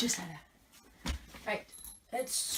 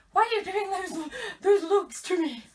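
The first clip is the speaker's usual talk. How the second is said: high, loud indignant protest; exasperated outburst, high-pitched and loud; mock outraged; 8 semitones higher, 14 dB louder than usual. Real, not acted. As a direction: call them out loudly, half laughing. Indignant challenge at the watching producer.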